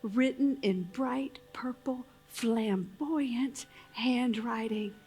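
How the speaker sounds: low, hushed inspirational close. Acted. Closing the show for the audience, hushed and inspiring.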